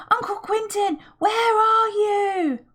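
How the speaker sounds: high, slow, long loud call; children calling loudly through the castle; shouting again. A narrator's reading. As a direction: yell for the missing uncle. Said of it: "They shouted again".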